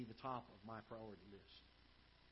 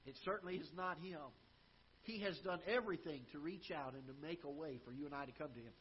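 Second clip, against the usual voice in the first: ordinary talk, nothing marked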